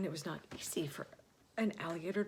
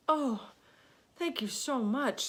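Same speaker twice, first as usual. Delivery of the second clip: higher, louder, relieved; grateful thanks; 7 semitones higher, 4 dB louder than usual. Acted. Alexander thanking the little boy, relieved and bright. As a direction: gush with relief.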